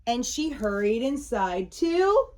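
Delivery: usual speaking voice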